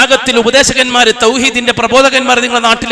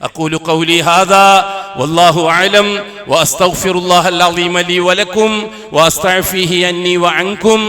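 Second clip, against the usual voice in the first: slow, lower Arabic prayer chant; closing khutbah prayer; 5 semitones lower, 3 dB quieter, slower than usual. Acted. Preacher closing the sermon, chanting the prayer. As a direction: close the sermon in measured prayer.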